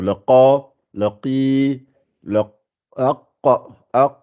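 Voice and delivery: the qaf in qa, qi and aq from the back of the throat; Arabic qaf letters. Shown as a demonstration. Teacher models qaf sounds for the class. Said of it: "Vous entendez, hein?".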